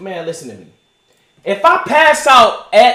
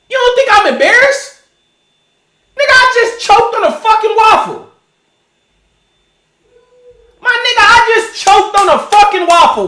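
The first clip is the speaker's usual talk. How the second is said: indignant rant; 7 semitones higher, 3 dB louder than usual. Retold or imitated. Ranting at imagined recorder.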